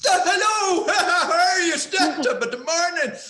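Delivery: loud, high-pitched mock Irish shouting; American actor butchering an Irish accent at full volume; bad Irish accent. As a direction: bellow a hammed-up stage Irish accent. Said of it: "American actors doing Irish stuff".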